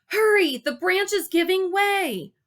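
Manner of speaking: high, loud and urgent; urgent shout. Acted. Gary warning Dale the branch breaks, urgent shout. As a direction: shout a warning across the river. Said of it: "Gary shouted".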